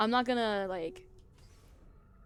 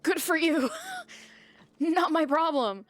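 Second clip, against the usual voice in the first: higher, louder, laughing sass; sassy laughing retort; 8 semitones higher, 6 dB louder than usual. Retold or imitated. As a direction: toss it off with a cackling sneer.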